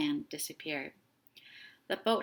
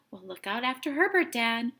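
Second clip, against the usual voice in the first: put-on child calling out to his dad, fast and louder; boy calling to his father on the boat; 4 semitones higher, 7 dB louder than usual. A narrator's reading. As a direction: call across to the boat. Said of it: "called Tim".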